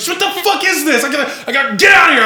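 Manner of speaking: high, loud and fast panicky shout; panicked yell. Retold or imitated. Kid panicking while brother hits him with a brush, loud high shout. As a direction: scream to escape the brush. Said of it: "I was like".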